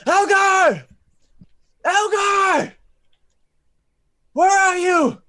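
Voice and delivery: calling out loud and high; yelling down into the dark; shouting. Acted. Character shouting for lost friend.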